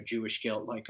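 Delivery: ordinary delivery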